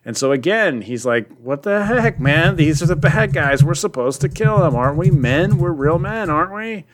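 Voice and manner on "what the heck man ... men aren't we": high, hammed-up macho outrage; mock indignant soldier. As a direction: imitate a soldier protesting at being held back. Acts out Ryan's angry protest.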